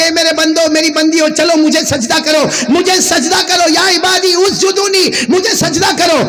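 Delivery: high, urgent sermon cry; impassioned pulpit voice; preaching God's call. Acted. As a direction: call out God's command to the crowd.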